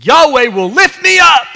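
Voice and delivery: loud, high, full-voiced proclamation; triumphant shout. Shown as a demonstration. Pastor performing his line in the children's psalm, shouted. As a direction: shout it out as a declaration.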